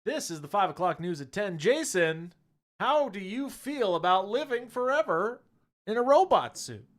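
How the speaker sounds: news anchor voice. Pretend.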